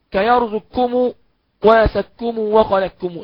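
slow recited كيرزقكم; Quranic example. Shown as a demonstration. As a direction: chant كيرزقكم وسكم وخلقكم slowly with the merge. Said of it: "زي كلمة إيه؟". (قراءة بطيئة بالإدغام)

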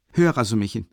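plain reading voice

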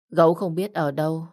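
plain reading voice

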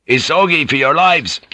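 loud order called to the crew. A narrator's reading. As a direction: call it out across the deck. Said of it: "he called out".

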